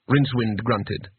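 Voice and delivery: ordinary delivery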